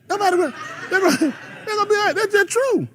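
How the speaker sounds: high-pitched talk through laughter; laughing. Real, not acted. Preacher joking about what people would say, laughing. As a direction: squeeze the words out while laughing.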